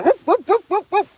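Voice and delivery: high siren-like whoop calls. Retold or imitated. Imitating a cop siren at her, high whoops. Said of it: "start saying".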